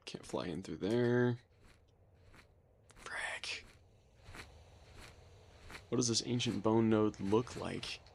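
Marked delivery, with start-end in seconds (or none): 2.9-3.6 breathy whispered swear; whispered curse. Real, not acted. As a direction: whisper it under your breath. Stuck, can't fly through.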